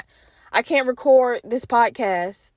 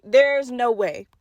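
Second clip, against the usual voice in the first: normal talking voice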